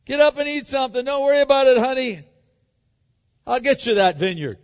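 loud, high, fast take-charge call; bossy wife. Retold or imitated. Jezebel to her pouting husband, imitated as loudly taking charge.

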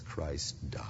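soft reverent whisper; hushed whisper. Real, not acted. Preacher to his congregation at the climax, whispered. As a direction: drop to a whisper for the cross.

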